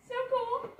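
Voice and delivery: very high, thrilled; excited squeal. Real, not acted. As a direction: squeal it with delight. Excited unboxing exclamation.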